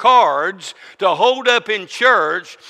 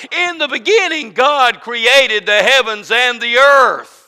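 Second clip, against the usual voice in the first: full-force preaching; 6 semitones higher, 6 dB louder than usual. Acted. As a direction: preach it up with rising fervour.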